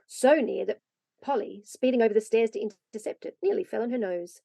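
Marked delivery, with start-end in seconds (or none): none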